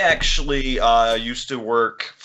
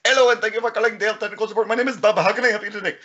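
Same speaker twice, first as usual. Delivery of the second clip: put-on fast, higher Indian accent support agent voice; 7 semitones higher, much faster than usual. Dell support agent answering a call in Indian accent. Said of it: "pulls India".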